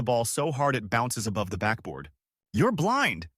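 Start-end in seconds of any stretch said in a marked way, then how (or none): 2.5-3.3 mock scream. A narrator's reading. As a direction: protest the referee's call in a raised pitch. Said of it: "he screams at the ref".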